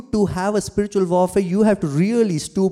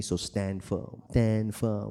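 soft, much quieter firm command; hushed exhortation; 7 semitones lower, 9 dB quieter, slower than usual. Acted. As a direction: press it home softly.

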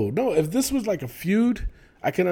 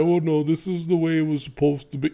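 mock whiny excuse. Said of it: "don't come out and be like".

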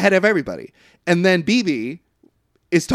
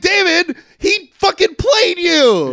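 high, loud, incredulous yell; exasperated shout; 14 semitones higher, 6 dB louder, slower than usual. Acted. Host yelling at Damon for being fooled, exasperated shout. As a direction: holler it with the last words stretched. Yelling back at film character.

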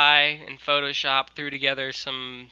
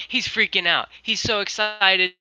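usual speaking voice

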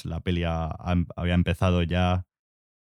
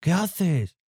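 retold yell; 10 semitones higher than usual. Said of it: "me grita Diego".

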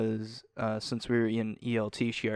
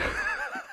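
high laugh burst. Real, not acted.

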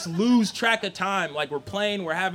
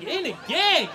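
higher, startled echo; incredulous question; 6 semitones higher, 3 dB louder than usual. Acted. Son echoing his dad's accusation, incredulous question. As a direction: repeat it in disbelief.

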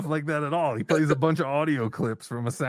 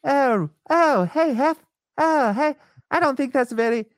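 much higher put-on old man voice; Hef impression; 11 semitones higher, 3 dB louder than usual. Shown as a demonstration. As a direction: squeak it out like an aging playboy. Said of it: "mine's horrible".

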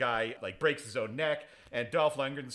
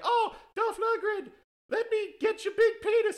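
mock lady voice; 5 dB louder than usual. Restaurant owner greets Dolph Lundgren in a high falsetto. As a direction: squeal it like a fawning restaurant owner. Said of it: "the owner comes up and she's like".

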